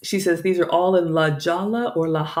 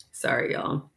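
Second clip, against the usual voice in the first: whispered apology; 5 semitones lower, 8 dB quieter than usual. Real, not acted. Embarrassed aside to viewers.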